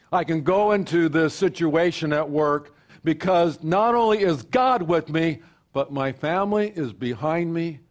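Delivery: building pastor's call to unity; preaching. Acted. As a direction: preach it out to the congregation. Preaching at full force.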